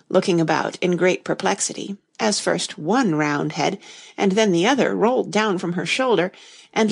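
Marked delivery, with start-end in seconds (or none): none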